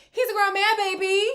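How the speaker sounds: high, loud exclamation; exasperated shout. Real, not acted. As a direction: holler it in exasperation. Exasperated outburst about his excuses.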